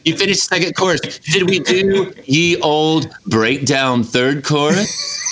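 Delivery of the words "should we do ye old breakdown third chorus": slow, booming, pompous; grand olde-timey call. Retold or imitated. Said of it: "And then you get to".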